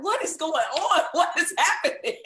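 high, loud, laughing disbelief; mock bewilderment. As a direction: act out baffled alarm, breaking into laughter. Acted bewilderment at people peeping in.

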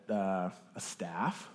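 slow, low, quiet and unsure; acted sheepish reply, drawn out; hesitant answer. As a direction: offer it tentatively, as a question. Moses answers God's question unsurely.

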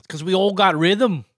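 light put-on Australian accent; a breezy Aussie drummer voice, lightly done. Joking about Australian drummers, a light Australian accent. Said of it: "That was good".

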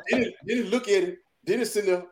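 usual speaking voice

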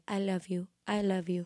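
soft, imitated singer's accent; [name]'s accent. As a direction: gush softly in a mock accent. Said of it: "that accent where she's like".

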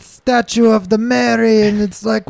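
mock Portuguese homeowner, musing on marble; fake Portuguese accent. Bragging about yard statues, fake Portuguese accent. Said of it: "racially ambiguous fucking accent".

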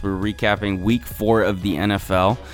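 normal talking voice

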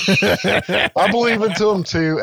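slow, high, laughing through it; laughing reluctant belief. Retold or imitated. Grumpy grandmother admitting belief, slow laughing and high. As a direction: give in grudgingly while laughing. Alva go, Fuck me.

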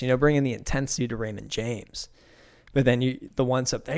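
usual speaking voice

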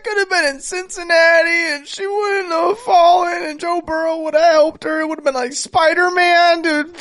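high-pitched, worked-up wail; on the verge of crying. Real, not acted. Telling co-hosts she wouldn't have fallen in Cincinnati, near tears. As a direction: plead in a high voice, close to tears. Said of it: "No, you're about to cry".

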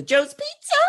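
perky put-on phone greeting, swooping up; Joe's Pizza girl. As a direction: chirp it like a pizza-shop greeter. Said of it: "she's talking like this".

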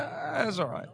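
high-pitched mock British reply; mock British accent. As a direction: put on a high British voice for a so-so verdict. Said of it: "a decent enough British accent?".

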